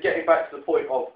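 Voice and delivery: normal talking voice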